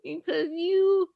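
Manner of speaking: high, choked-up voice; tearful. Real, not acted. Daughter admitting she deserves a daughter like herself, tearful. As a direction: push the joke out through tears. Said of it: "I'm gonna cry".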